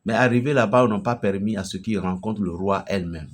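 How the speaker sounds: usual speaking voice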